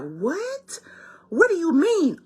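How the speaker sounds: high, disbelieving What?; mock shock at being left hanging; baffled. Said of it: "And we're like".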